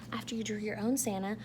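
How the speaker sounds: usual speaking voice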